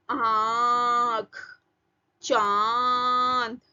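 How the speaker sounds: आँख, चांद, सांप with long vowels sounded through nose and throat; nasalised vowels. Shown as a demonstration. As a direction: draw out the vowel of आँख, गाँव very slowly through open mouth and nose. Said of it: "गले से आवाज आ रही है और नाक से आवाज आ रही है।".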